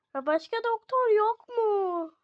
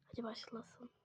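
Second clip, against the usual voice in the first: soft whispered cue; whispered go-ahead; 7 semitones lower, 22 dB quieter than usual. Pretend. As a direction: breathe it without voice. Quiet cue before the birth.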